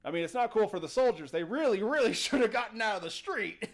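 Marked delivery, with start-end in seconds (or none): 1.3-3.7 loud, higher, breaking into a laugh. Real, not acted. Host on the doomed soldiers who ignored warnings, laughing. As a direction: laugh through the emphatic words.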